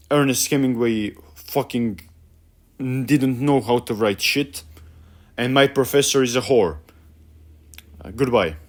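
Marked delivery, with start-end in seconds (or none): none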